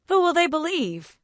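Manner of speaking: high mocking sneer. A narrator's reading. Senator taunts Chloe, sneering. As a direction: taunt her with contempt. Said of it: "the senator sneered".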